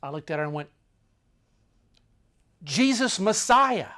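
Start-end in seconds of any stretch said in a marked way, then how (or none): 2.6-3.9 higher, louder and slow. Retold or imitated. Translating what he told the girl, loud. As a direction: announce it like a revelation. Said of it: "That's what I said to her".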